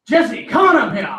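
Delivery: loud high call to stage. Real, not acted. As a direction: call him up loudly. Calling someone to the stage.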